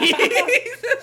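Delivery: high, laughing through the word. Real, not acted. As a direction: crack up mid-word. Laughing at the line.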